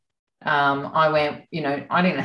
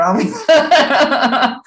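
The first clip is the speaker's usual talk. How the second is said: much higher, louder, slow through laughter; laughing punchline; 8 semitones higher, 6 dB louder, much slower than usual. Retold or imitated. Voicing his insistent energy, laughing. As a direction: stretch it out laughing.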